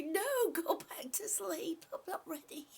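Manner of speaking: whispered sleepy protest; mock sleepy eyes. Voicing her sleepy eyes, a lightly whispered protest. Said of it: "They say".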